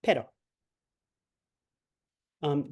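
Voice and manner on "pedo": Pedo with a single light tapped d like Spanish r; soft tapped r. Shown as a demonstration. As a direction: flick the tongue once in Pedo. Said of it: "it's gonna be that soft ra".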